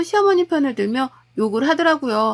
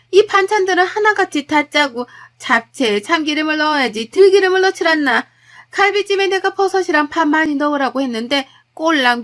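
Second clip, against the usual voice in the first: higher, louder nagging mother-in-law tirade; mother-in-law picking apart the birthday meal, scornful and loud; scolding mother-in-law; 4 semitones higher, 4 dB louder than usual. Acted. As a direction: berate her cooking and her family, sharp and contemptuous.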